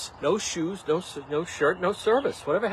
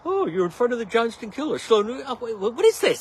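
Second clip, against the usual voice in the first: mocking commenter; 7 semitones higher, 4 dB louder than usual. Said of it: "mocking the comment".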